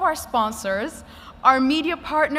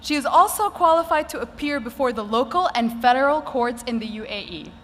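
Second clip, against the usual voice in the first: ordinary talk, nothing marked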